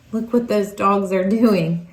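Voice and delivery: ordinary delivery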